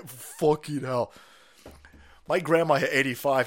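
ordinary talk, nothing marked

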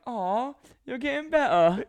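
high, slow, sing-song "Aw, you're getting better"; mock praise. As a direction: coo it like praising a child, played up. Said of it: "And then you were like".

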